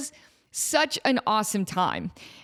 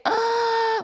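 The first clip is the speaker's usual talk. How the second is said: high-pitched mock gasp; exaggerated gasp; 13 semitones higher, 3 dB louder than usual. Friends watching the proposal, imitated high gasp. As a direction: imitate an overwhelmed gasp-girl "ah". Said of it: "like, gasp girl face".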